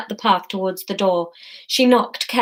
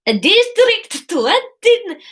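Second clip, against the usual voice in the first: put-on very high, drawn-out Spanish accent; attempted Spanish accent; 12 semitones higher, 4 dB louder, much slower than usual. Courier answering who it's from, hammed-up Spanish accent.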